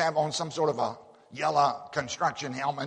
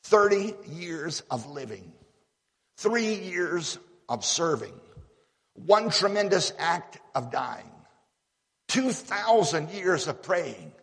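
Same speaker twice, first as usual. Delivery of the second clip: slow, measured quotation, pausing between phrases; solemn recitation; 3 semitones higher, slower than usual. Retold or imitated. Preacher quoting Oswald Sanders to the church, slow and solemn. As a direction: let each phrase land. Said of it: "Listen to Oswald Sanders".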